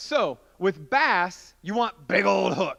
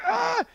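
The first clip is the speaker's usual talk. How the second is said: high, loud yelp of effort; strained cry; 6 dB louder than usual. Retold or imitated. Novice fighting a big bass on the line, straining cry. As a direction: yelp as if hauling hard. Imitates straining against the fish.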